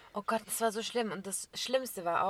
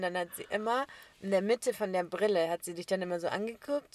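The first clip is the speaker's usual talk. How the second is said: ordinary talk, nothing marked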